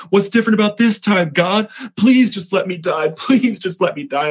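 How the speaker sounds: reliving an anguished yell at God in the car; retold crying yell. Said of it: "I'm crying and I'm yelling at him".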